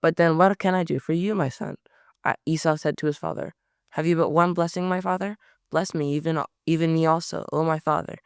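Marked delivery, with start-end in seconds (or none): none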